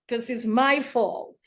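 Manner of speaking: normal talking voice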